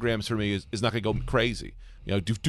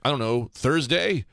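mock clueless guess; 5 semitones higher, 5 dB louder, slower than usual. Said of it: "And I was like ... trying".

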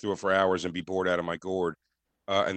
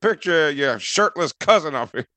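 louder, higher sarcastic mockery; sarcastic jab; 8 semitones higher, 8 dB louder than usual. [name] mocking the mother about the shirtless photo, loud and sarcastic. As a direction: sneer it like a jealous ex.

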